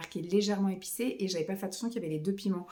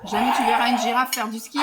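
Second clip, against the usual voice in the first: laughing; 2 semitones higher, 10 dB louder than usual. Real, not acted. Laughing reply to her child.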